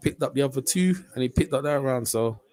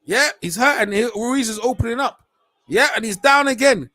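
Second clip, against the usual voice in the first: high and loud, thrilled call; excited yell; 7 semitones higher, 5 dB louder than usual. Real, not acted. Calling the knockdown to the stream, thrilled yell. Fighter knocked down, watcher erupts.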